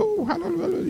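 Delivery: very high, airy little squeal; mock high squeal of hallelujah; mock scream of joy. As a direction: imitate a girl shrieking praise. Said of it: "She didn't start screaming".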